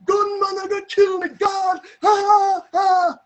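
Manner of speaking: very high, loud panicked screams; shouting. Retold or imitated. Neighbour lady shouting that a gunman will kill her, screaming. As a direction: scream for your life, breaking into loud wails. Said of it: "and lady shouting".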